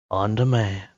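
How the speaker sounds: light trailer announcer tag line; a hint of the trailer narrator; movie trailer voice. Shown as a demonstration. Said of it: "The, the trailer voice guy".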